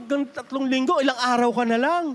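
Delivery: ordinary delivery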